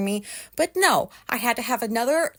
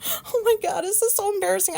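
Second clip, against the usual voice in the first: mortified; 9 semitones higher than usual. Real, not acted. Mortified outburst after telling story.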